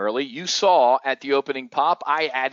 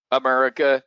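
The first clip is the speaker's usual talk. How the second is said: ordinary talk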